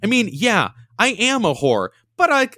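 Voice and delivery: high, unbothered mock matter-of-fact voice; high breezy imitation of a friend; quoting Sarah. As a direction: shrug it off brightly like Sarah. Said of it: "she goes like".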